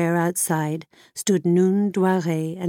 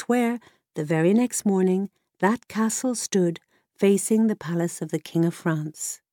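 plain reading voice

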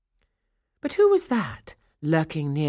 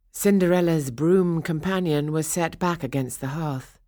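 ordinary delivery, nothing marked

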